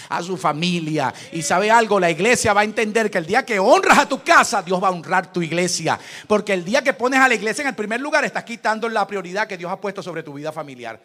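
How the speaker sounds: loud fervent sermon cry; shouting pulpit preaching; preaching at full force. Acted. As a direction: preach it to the whole church, full voice.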